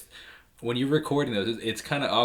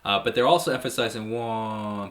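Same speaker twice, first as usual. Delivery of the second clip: ordinary talk, nothing marked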